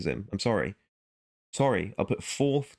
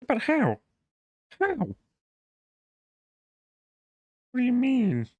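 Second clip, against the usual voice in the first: high, hammed-up incredulous Peterson; Jordan Peterson impression. Mock Peterson voice questioning an imagined opponent. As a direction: play a pundit baffled by a bad argument. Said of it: "I probably sounded nothing like Jordan Peterson".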